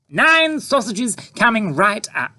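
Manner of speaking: falsely charming to the tramp; sickly sweet mother. A narrator's reading. Mother eager.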